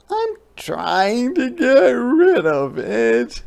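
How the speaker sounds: high, slow, whimpery hedgehog voice; squeaky snuffly complaint; sniffling hedgehog. Acted. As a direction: sniffle it out, close to tears. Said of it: "sniffled Hedgehog".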